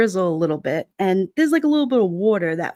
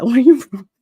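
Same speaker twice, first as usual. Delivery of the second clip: loud, higher mock echo; out-loud retort, pitched up; 4 semitones higher, 9 dB louder than usual. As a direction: throw the question back loudly. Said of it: "don't say it that loud".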